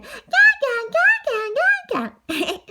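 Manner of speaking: put-on very high, loud baby voice; baby babble. Baby Cody joins in at shrimp peeling, high babbling. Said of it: "the little baby Co-, Cody said".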